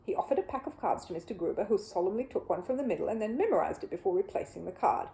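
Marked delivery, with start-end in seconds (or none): none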